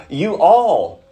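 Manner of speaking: "you all" pitched high and stretched out; emphatic plural you. Shown as a demonstration. Preacher explaining the plural to the congregation, emphatic "you all". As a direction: push "you all" up, addressing the whole group. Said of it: "it's plural".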